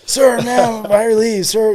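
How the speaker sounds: high, loud recruit callout; retold recruit response. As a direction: imitate a recruit sounding off in passing. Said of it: "you're supposed to say".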